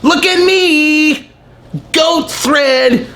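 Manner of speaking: very high, slow, drawn-out attention-seeking voice; thirsty Instagram model. Retold or imitated. Vlogger imitating a thirsty Instagram model, high and drawn out. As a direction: stretch it out like a model begging for likes. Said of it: "a thirsty Instagram model that's like".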